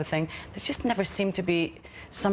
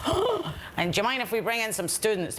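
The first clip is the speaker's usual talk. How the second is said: higher, mock polite staff voice; airy mock-cheerful staff request; mimicking staff; 7 semitones higher than usual. Mocking a nurse who asks to bring in students, higher and overly polite. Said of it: "And I was like".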